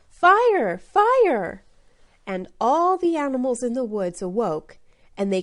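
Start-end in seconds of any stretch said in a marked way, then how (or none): 0.1-1.6 shouting. A narrator's reading.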